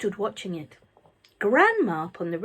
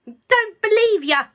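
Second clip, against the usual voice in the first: put-on high, loud, slow crabby old woman; grumpy grandma; 13 semitones higher, 10 dB louder, slower than usual. A narrator's reading. Grandma scoffing at George's claim, crabby and loud. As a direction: snap it back like a cranky granny. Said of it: "she answered".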